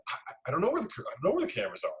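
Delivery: flustered fast stammer; mock flustered line producer. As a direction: rush it, caught out. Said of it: "flustered line producer".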